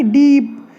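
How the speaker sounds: डीप with a drawn-out 'ee'; long ई sound. Shown as a demonstration. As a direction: hold the vowel of डीप to demonstrate it. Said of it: "ई की ध्वनि आ रही है". (डीप में खिंची हुई ई)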